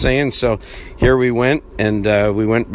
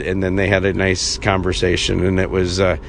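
ordinary talk

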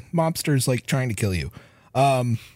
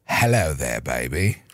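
mock Australian woman; 11 semitones lower, slower than usual. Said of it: "an Australian woman trying to".

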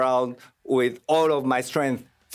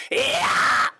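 sharp unvoiced metal scream; high metal scream. Shown as a demonstration. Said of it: "I can scream in higher pitch".

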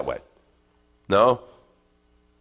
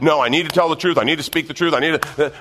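higher, insistent mock protest; insistent self-justifying; 6 semitones higher, 5 dB louder than usual. The flesh justifying a marital argument, insistent and higher. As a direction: act out someone arguing their case.

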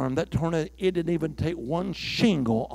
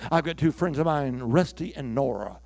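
usual speaking voice

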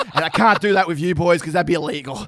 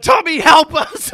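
drawn-out, louder cry for help, pitched up; panicked plea; 9 semitones higher, 8 dB louder, slower than usual. Retold or imitated. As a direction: players begging their teammate while being blown away. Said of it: "It's like".

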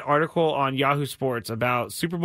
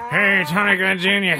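lightly voiced teasing call-out; a hint of a mimicked co-host; co-host impression; 4 semitones higher, 5 dB louder than usual. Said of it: "I can only do that because he's not here".

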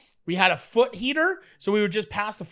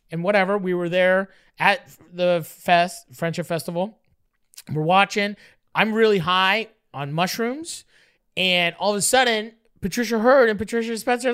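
usual speaking voice

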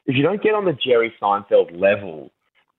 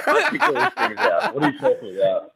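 jumps much higher, laughing; laughing protest; 11 semitones higher than usual. Retold or imitated. Host retelling his reply to Jerry, laughing. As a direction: defend yourself while cracking up.

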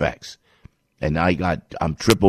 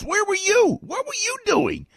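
indignant high outburst. Real, not acted. Ranting at the president.

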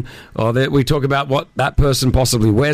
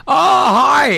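surprised greeting; 13 semitones higher, 5 dB louder than usual. Retold or imitated. Greeting the caught couple.

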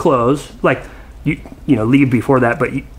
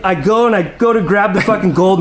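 ordinary talk, nothing marked

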